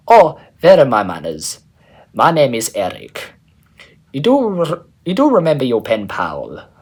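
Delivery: put-on loud, lightly done German accent; a hammed-up German accent, self-admittedly off. Eric greeting Geistes in a loud German accent. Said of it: "Eric has a pretty good German accent".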